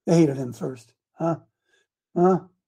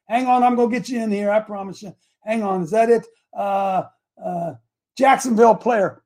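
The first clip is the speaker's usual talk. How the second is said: ordinary delivery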